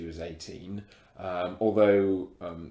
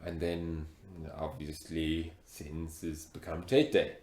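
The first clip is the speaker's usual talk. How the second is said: put-on heavy African accent; 4 semitones lower than usual. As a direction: speak with a thick African accent. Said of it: "you've got a very thick accent".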